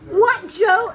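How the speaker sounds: mother snapping back at uncle; snapped "What, Joe?". Retold or imitated. Mother snaps at uncle Joe, loud and fed up. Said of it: "And she says".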